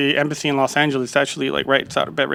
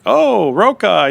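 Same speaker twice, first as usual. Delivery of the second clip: slow, higher and louder "Oh, Roca"; impressed recognition; 7 semitones higher, 5 dB louder, much slower than usual. Retold or imitated. Embassy clerk sees the Roca offer letter, slow impressed recognition. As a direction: drag it out, impressed by the name.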